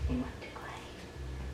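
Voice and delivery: slow breathy whisper; slow ghostly whisper, teasing; eerie whisper. Acted. Eerie playful voice in dream.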